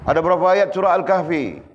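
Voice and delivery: ordinary talk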